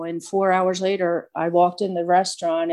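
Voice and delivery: ordinary talk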